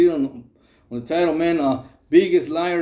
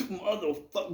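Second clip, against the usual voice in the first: mock pained groan; 12 dB quieter than usual. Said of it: "He goes".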